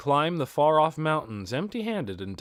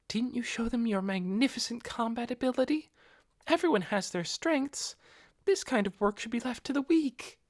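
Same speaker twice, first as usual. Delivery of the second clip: much higher, proud devoted woman's voice; haughty servant; 12 semitones higher than usual. Acted. Narbrul praising Ainz's might to him, haughty high voice. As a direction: looks down on the weak, lofty and sure.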